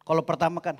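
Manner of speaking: ordinary talk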